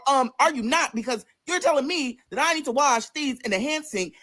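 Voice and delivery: high-pitched, incredulous challenge; indignant grilling. Retold or imitated. Retold confrontation with co-worker.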